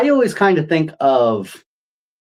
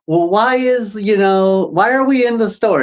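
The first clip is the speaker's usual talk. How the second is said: put-on little kid asking why; 8 semitones higher than usual. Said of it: "like you're a little kid".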